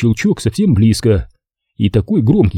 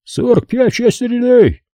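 high-pitched Brooklyn desk cop; sudden high-pitched official call on the line; booming phone voice; 13 semitones higher, slower than usual. A narrator's reading. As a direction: call it out high, a garbled police dispatcher. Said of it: "снова грянул голос".

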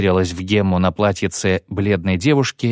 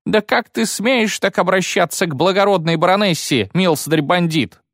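1 indignant matron; 9 semitones higher than usual. Acted.

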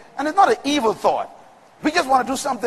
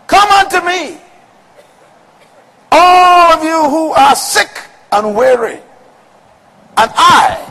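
loud, slow, shouted preaching climax; hammering each line like a revival preacher; full-force preaching; 4 semitones higher, 8 dB louder, slower than usual. Acted. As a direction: preach it out to the whole room, building to a shout.

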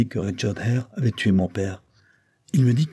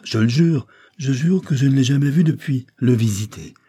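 higher, louder sworn denial; earnest protest; 5 semitones higher, 4 dB louder than usual. A narrator's reading. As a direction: swear it urgently, pitch raised. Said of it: "L'accent de la vérité était dans les paroles et dans le ton". (protestation sincère, voix plus haute et forte)

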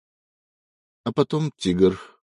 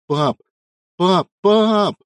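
rising, louder anguished shouts; roaring cry; 11 semitones higher, 5 dB louder, much slower than usual. A narrator's reading. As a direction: howl for his dying father. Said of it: "проревел Эва".

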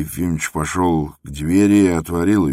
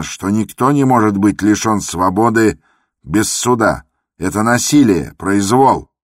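loud, higher furious protest; angry outburst; 5 semitones higher, 6 dB louder than usual. Acted. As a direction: rage at the injustice, full voice. Иван Дмитрич возмущённо кричит. (громкий гневный протест)

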